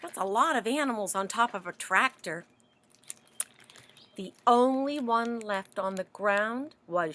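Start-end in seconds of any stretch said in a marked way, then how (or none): none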